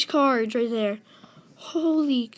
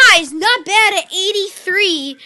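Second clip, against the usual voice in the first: excited; 5 semitones higher, 7 dB louder than usual. Real, not acted. Pleased after the reveal.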